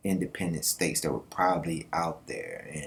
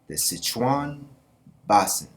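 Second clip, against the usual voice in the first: The Sichuan Basin in a hammed-up Chinese accent, higher and louder on Basin; mock Chinese accent; 5 semitones higher, 6 dB louder than usual. Vlogger showing the map, slipping into a mock Chinese accent. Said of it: "Why am I saying that with an accent?".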